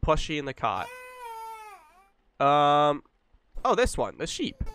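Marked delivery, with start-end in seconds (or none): none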